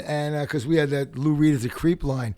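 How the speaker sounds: normal talking voice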